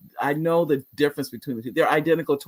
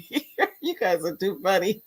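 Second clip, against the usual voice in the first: laughing; 4 semitones higher, 4 dB quieter than usual. Real, not acted. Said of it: "I'm laughing".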